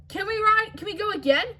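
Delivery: eager. Acted. Excited kid begs to ride again.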